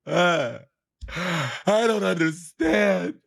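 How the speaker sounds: high, drawn-out mock wail; hammed-up slow drunken sobbing; imitated sobbing. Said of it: "like, sobbing".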